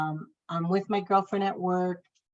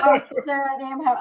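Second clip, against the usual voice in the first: incredulous echo; 7 semitones higher, 7 dB louder, much faster than usual. Retold or imitated. She echoes him as a question.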